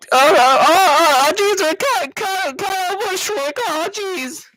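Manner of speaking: very high, panicky stammering squeal; Morty. Acted. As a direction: stutter loudly at the top of your voice, easing off. Plays Morty stammering an answer.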